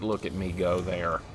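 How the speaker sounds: ordinary talk, nothing marked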